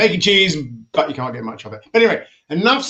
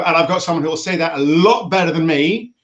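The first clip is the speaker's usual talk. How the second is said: ordinary talk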